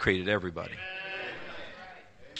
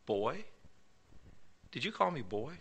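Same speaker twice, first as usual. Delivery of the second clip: quiet challenge; 14 dB quieter than usual. Retold or imitated. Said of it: "he looked at him and he said".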